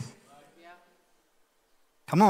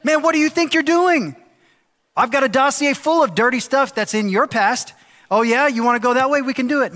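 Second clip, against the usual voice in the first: much higher, louder, hammed-up menacing; mock threat; 9 semitones higher, 5 dB louder than usual. Pastor imagining Daniel threatening the co-sponsors, hammed-up angry. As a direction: bluster like a schoolyard tough with dirt on you.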